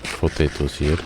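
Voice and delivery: put-on brief Russian accent. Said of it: "Probably shouldn't do a Russian accent".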